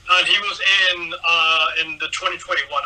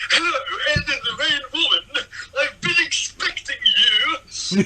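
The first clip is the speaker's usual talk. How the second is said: high-pitched creepy character impression, drawn out at the end; John Noble; 10 semitones higher, slower than usual. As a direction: put on an exaggerated movie-villain greeting. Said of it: "the way he talks in this film".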